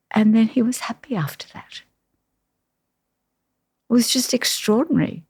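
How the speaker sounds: a real, choked-up whisper after tears; barely voiced, still moved by the memory; whispered through real emotion; emotional whisper. Real, not acted. Emotional memory of husband's death.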